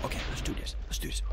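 very soft, near whisper; hushed psyching up. Real, not acted. Gamer readying for the push, hushed. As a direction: murmur it secretively to yourself.

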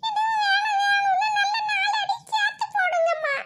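high shouted tattle urging mom on; tattling shout. Acted. Younger daughter eggs on mother, shouting high. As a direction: yell it up high to get sister beaten.

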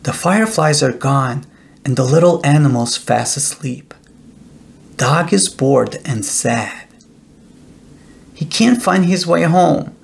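ordinary delivery

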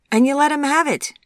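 high, loud enthusiastic cry; rousing shout. A narrator's reading. Chip coaches her rock throwing, rousing shout. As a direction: spur her on at full voice.

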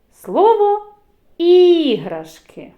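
«іграшки» with loud, stretched initial «і»; stressed i. Shown as a demonstration. Teacher highlighting the vowel for children, stressed. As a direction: lean on the «і» in «іграшки» slowly. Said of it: "виділяю голосом".

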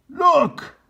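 high loud alarm; shout. Acted. Captain Bill shouting to his men, alarmed. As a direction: call the men's eyes over. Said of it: "shouted Captain Bill".

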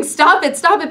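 very high, very loud repeated plea; screaming. Acted. Girl to boys who kept throwing stones, desperate screaming. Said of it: "I just kept screaming".

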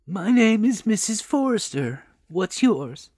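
lightly voiced helpful old lady; kindly old woman. A narrator's reading. Old woman introduces herself.

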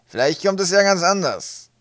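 loud, high, drawn-out creature voice; grinning gnome. A narrator's reading. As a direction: play a cheeky gnome, slowly and loudly. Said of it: "das grinsende Gesicht eines Pöbelgnoms".

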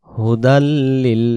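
drawn-out Quranic recitation; slow recitation. Shown as a demonstration. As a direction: recite slowly, stretching every vowel. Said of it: "Quran reading lesson". (ধীরে তিলাওয়াত)

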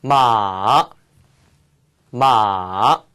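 마 dipped down low and held; Chinese third tone. Shown as a demonstration. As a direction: sink 마 to the lowest pitch. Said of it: "제 삼성 연습해 볼게요".

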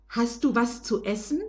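ordinary delivery, nothing marked

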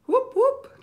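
high, loud cheering whoops. Real, not acted. Streamer cheering fans from Louisiana with high whoops. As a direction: cheer for Baton Rouge like a crowd.

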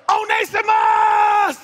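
slow, high, loud call of a name; God calling out. Acted. God calling Onesimus once he has heard, slow loud call. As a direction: stretch the name out in a shout.

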